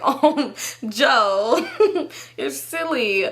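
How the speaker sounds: laughing, put-on playful dismissal; fake giggly fondness. Hiding his cussing from company, fake giggling. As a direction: giggle it off to cover embarrassment. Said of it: "I used to be embarrassed".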